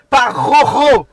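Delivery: Rojo avec une jota raclée, fort et lent; Spanish R demo. Shown as a demonstration. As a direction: imite la jota espagnole dans Rojo. (Rojo prononcé à l'espagnole, exagéré)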